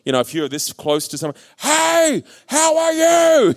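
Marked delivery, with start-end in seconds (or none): 1.6-3.5 very high, drawn-out holler; mock shout. Shown as a demonstration. Preacher showing shouting at someone close by, mock shout. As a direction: holler as if the person were far away. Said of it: "you don't shout at someone you're close to".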